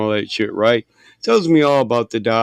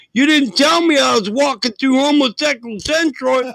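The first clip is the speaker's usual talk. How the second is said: much higher furious shout; shouting at mom; 13 semitones higher than usual. Retold or imitated. On the bar payphone blaming his mom, a full shout. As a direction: yell it over the bar music. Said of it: "And I'm yelling".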